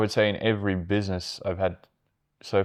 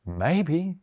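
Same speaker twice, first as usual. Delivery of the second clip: high, unhurried British "maybe", rising; soft-edged, high British radio reply; 8 semitones higher, 4 dB quieter than usual. Retold or imitated. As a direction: answer lightly in a lifted British voice. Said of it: "they would just be like".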